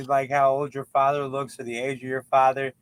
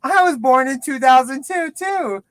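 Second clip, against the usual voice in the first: high, loud surprised "Huh?"; young cashier blurts out her surprise; 9 semitones higher, 6 dB louder than usual. Retold or imitated. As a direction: act startled at the shared birth year. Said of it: "the girl behind the counter was like".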